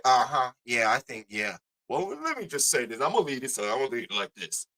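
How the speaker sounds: low, soft, hedging mumble; mumbling like the co-host dodging it; mock mumble. Mocking the co-host's mumbled non-answer, low and soft. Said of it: "But he over there".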